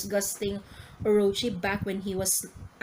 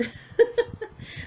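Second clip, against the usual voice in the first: a high airy burst of laughter; high breathy laugh. Real, not acted. Laughing at crying crew.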